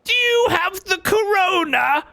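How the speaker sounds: very high, squeaky cartoon voice; squeaky character voice. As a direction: squeak like a panicky cartoon critter. Same squeaky voice.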